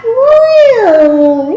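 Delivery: high, loud rising meow; a big show meow for the kids; cat meow. Shown as a demonstration. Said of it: "And back to cat".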